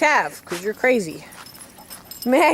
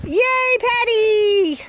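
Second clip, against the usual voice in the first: fake cheerful; 10 semitones higher, much slower than usual. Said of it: "make it sound like it's fun".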